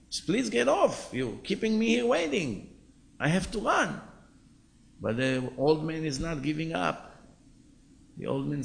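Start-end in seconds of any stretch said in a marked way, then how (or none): 0.1-4.0 exasperated plea. Retold or imitated. Driver loses patience with passenger.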